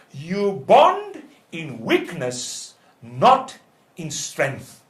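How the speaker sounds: drawn-out preacher's key point; slow and emphatic. Real, not acted. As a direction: drive it home very slowly, word by word. Preacher drives home the key point.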